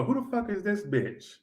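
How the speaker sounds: put-on quoted girlfriend. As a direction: slowly recall the jealous girlfriend. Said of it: "to get that scene in my head".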